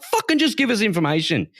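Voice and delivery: high, fed-up outburst; exasperated. Real, not acted. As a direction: snap out your impatience. Exasperated reaction to the speech.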